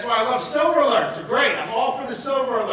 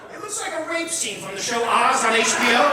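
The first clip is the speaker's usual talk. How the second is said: normal talking voice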